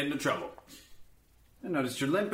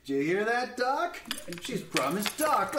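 ordinary talk

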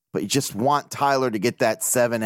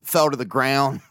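usual speaking voice

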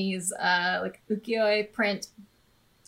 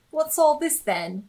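a hint of an old British bobby; lightly mock English copper; retelling a colleague's line, mildly British; retold British quip; 6 semitones higher, 3 dB louder than usual. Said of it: "you said".

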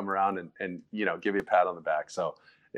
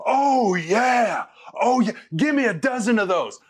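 much higher and louder, hyped shout; fired-up coach; 12 semitones higher, 4 dB louder than usual. Retold or imitated. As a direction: explode with delight like a coach after a perfect throw. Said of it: "And he goes".

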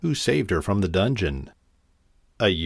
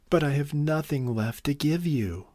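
soft, high storybook despair; understated sob, helpless and softer; sobbing girl; 5 semitones higher, 4 dB quieter than usual. A narrator's reading. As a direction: plead tearfully in a higher voice. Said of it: "sobbed the girl".

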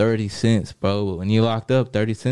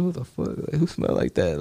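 disgusted disbelief; 7 semitones higher, 3 dB quieter than usual. Retold or imitated. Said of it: "I'm like".

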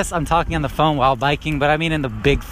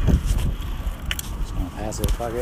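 quiet; 5 semitones lower, 6 dB quieter, much slower than usual. Real, not acted. Aside while biking past.

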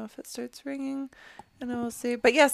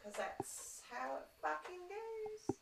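exasperated muttering; 4 semitones higher, slower than usual. Real, not acted. Exasperated muttering at broken tech.

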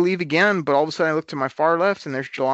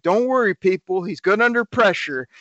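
fan heckling; 5 semitones higher than usual. Retold or imitated. Heckling fans from the bleachers.

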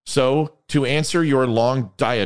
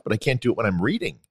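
normal talking voice

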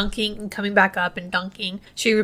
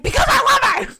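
high, loud passionate shout; shouted confession; 5 dB louder than usual. Retold or imitated. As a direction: blurt out the love, full force. Dramatic love confession, shouted.

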